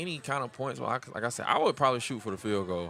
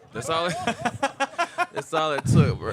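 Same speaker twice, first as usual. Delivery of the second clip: laughing; 6 semitones higher, 5 dB louder than usual. Real, not acted. Laughing at the game swing.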